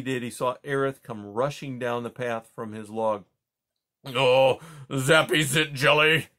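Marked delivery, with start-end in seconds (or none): 4.0-6.3 loud, odd-voiced porcupine exclamation; loud creature cry. A narrator's reading. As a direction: blurt it out as the fussy porcupine. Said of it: "cried the porcupine".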